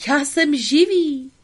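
high, loud, slow astonished cry; puppet's cry. Acted. Pinocchio discovering speech cries out, high and slow. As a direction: shout it out, amazed at your own voice. Pinocchio exults at being alive.

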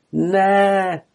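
high, drawn-out cry. A narrator's reading. Kenai discovers he has a tail, cries out. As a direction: exclaim in shock, pitch well up. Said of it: "vykřikl Kenai".

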